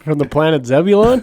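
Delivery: excited teasing rise on Zebulon; mock sci-fi wonder. Real, not acted. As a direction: play it like a cheesy space show. Joking about the sci-fi name.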